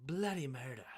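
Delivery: a bad put-on British accent, mock horror; amateur British accent, self-mocking; terrible British accent. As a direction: try a clumsy British accent on it. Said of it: "That was a terrible, terrible British accent".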